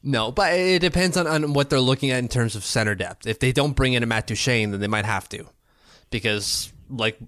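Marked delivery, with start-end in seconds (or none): 6.1-7.2 burping. Real, not acted. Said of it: "excuse me, I'm burping here".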